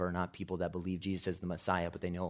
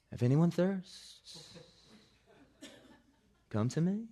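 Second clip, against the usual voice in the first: slow, higher-pitched mock-mellow delivery; nonchalant movie Jesus; 8 semitones higher than usual. Film Jesus rolls around a pillar, mock nonchalant. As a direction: drift through it lazily, lilting upward. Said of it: "this philosophical idea that Jesus is just".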